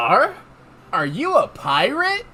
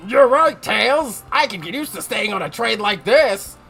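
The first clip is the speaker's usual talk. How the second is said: character voice; 2 semitones higher, 3 dB louder than usual. Acted. Voicing another Sonic character.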